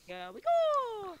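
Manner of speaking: very high, loud, slow cartoon cry; Mario voice. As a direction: shout it like the game hero. Quoting Mario from the game.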